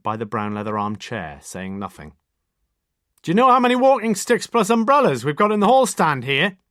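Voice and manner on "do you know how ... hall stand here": much higher and louder, calling from the hall; shouting. A narrator's reading. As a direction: Lewis shouting across the flat. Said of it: "shouted Lewis from the tiny entrance area".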